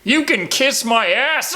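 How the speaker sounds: high, slow, drawn-out taunt; hammed-up high taunt; mock defiant scream. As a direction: yell it like a hurt thug who still mouths off. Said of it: "screaming things like".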